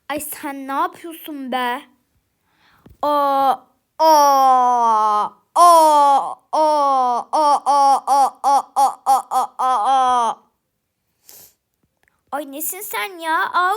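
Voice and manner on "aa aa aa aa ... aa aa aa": long screams. Acted. Screams at a startling sight.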